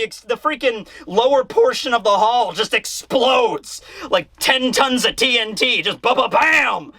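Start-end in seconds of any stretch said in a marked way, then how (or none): 6.0-6.9 shouted, stretched-out blast noise; imitated explosion. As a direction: boom it out like a blast.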